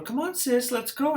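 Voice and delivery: high, chirpy mom voice; imitating a mom. Mom in a store calling her daughter along, high and chirpy. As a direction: hurry the kid along, pitched high.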